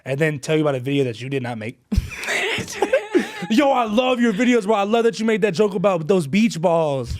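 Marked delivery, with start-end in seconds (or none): 1.8-3.5 sudden high laugh; high burst of laughter. Real, not acted. Laughing at fan mix-up. 3.5-7.2 a hint of an excited fan, laughing through; mock eager fan. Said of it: "have you ever had somebody go".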